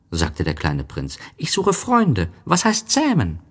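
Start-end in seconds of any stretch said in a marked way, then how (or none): 1.4-3.4 put-on high childlike story voice; voiced little prince, lifted well up. A narrator's reading. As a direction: pitch it high for a small boy.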